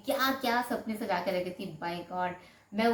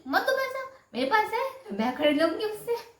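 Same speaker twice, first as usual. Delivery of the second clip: much higher, louder indignant snap; defiant retort; 13 semitones higher, 5 dB louder than usual. Retold or imitated. Wife retorts to husband refusing money, high and loud. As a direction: throw it back at him in defiance.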